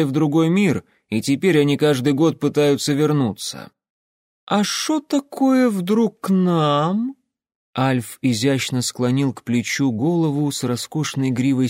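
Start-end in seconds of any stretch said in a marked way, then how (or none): 4.5-7.1 high, slow, drawled question; a sly folk character's voice; drawling character voice. Acted. Character voice in read story.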